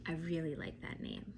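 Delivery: gasp, then a soft whispered delight. Real, not acted. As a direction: whisper it like a secret treat. Teacher reacting to a child's answer.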